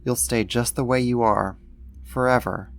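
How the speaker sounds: ordinary talk, nothing marked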